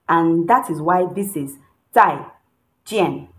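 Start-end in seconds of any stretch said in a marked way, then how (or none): 1.9-3.3 "Tai" and "Jian" each dropped in pitch; falling tones. Shown as a demonstration. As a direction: drop the sound on "Tai" and "Jian". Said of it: "you're dropping the sound".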